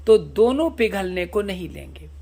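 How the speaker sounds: usual speaking voice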